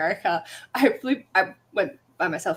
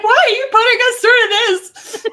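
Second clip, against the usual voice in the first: high, loud, laughing mock wail; mock anguished cry; 13 semitones higher, 11 dB louder than usual. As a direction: wail it like a kid betrayed by a book. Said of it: "It was like".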